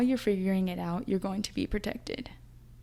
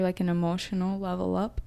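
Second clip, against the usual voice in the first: ordinary talk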